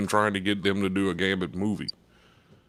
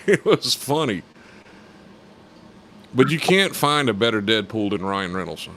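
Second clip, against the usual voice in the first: ordinary talk, nothing marked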